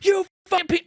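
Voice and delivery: high, loud mock insult cut off; imitating an angry insult. Caller mock-yelling an insult he never said. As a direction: pretend to snap an insult, then stop. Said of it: "I'm not sitting there going".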